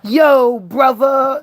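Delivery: mock slang greeting with the r dropped, brotha. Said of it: "They didn't say brother yet".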